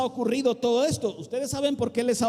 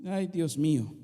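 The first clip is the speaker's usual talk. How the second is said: mild whine. Shown as a demonstration. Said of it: "cuando Dios nos dice algo así".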